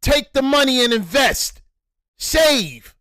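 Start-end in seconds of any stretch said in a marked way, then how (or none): none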